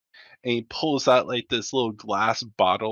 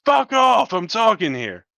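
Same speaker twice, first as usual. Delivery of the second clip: angry shout; 11 semitones higher, 8 dB louder than usual. Acted. Guard snaps at prisoner.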